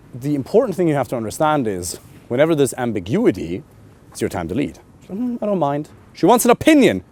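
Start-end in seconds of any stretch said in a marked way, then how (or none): 5.1-5.9 high, noncommittal put-on voice; imitated indecisive woman. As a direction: hum and shrug it off lightly. Voicing an undecided woman.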